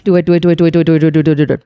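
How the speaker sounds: rapid-fire low repeated "do it"; frantic giving in. Retold or imitated. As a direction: rattle it off in a mock desperate rush. Acted hurried giving in.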